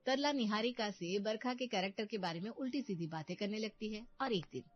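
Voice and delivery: usual speaking voice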